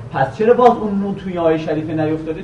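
ordinary talk

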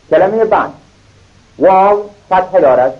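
ordinary delivery

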